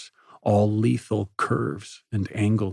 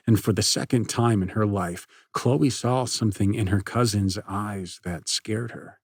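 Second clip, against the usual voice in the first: usual speaking voice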